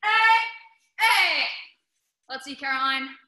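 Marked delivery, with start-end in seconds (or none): 0.0-1.5 high, forceful shouts, one after another; three loud yells. Shown as a demonstration. Teacher modelling the three board-break yells, loud shouts. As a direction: fire off separate martial arts yells. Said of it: "gonna do the same thing".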